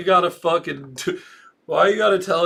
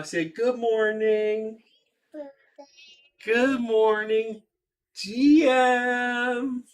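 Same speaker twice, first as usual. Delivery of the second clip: slow, higher sing-song parent voice; 6 semitones higher, much slower than usual. Real, not acted. Parent teaches kid to greet the stream, slow sing-song. Modelling words for small child.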